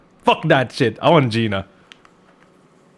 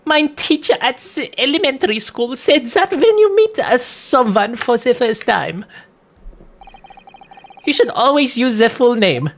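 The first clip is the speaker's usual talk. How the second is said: lightly voiced, much higher formal boy; reading a character; 8 semitones higher than usual. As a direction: read it up high like a prim schoolboy.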